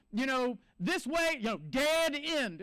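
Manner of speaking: slow, loud warning, words drawn out; emphatic warning. Retold or imitated. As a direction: spell out the danger, slowly. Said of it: "Plenty of signs that said".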